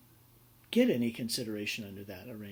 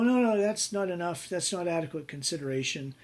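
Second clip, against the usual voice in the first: higher, louder mock objection; indignant objection; 6 semitones higher, 6 dB louder than usual. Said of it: "And I go".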